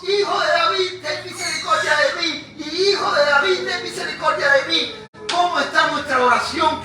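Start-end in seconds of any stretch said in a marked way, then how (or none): 0.0-4.9 high tearful shout, voice breaking; crying out loudly. Retold or imitated. Blind man shouting to Jesus for mercy, high and tearful. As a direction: cry out for mercy near tears. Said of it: "Y gritó a gran voz".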